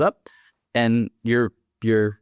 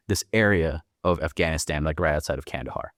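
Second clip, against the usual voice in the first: normal talking voice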